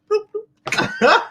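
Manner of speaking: high bubbly bloop like a fish tank; playful mock underwater bloop; bloop sound. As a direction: imitate water blooping as she goes under. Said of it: "the sounds you play in the fish tank".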